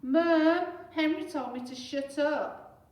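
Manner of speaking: high, loud and slow; tattling whine. Acted. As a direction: call out to tell tales. Peter telling on Henry.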